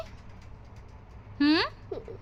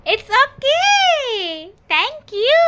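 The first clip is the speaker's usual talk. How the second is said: much higher, very drawn-out sweet voice; cutesy baby talk; 11 semitones higher, 6 dB louder, much slower than usual. Acted. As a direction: stretch it high and sugary like a toddler. Mother mimics child's reply, cutesy.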